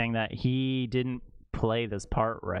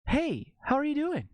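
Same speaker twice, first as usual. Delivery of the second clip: high, chirpy mock greeting, fast at the end; mock Mark Wahlberg; 12 semitones higher than usual. Mocking the actor who shows up in everything, a high, chirpy greeting. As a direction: play him as an eager guy popping up everywhere. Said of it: "he's just".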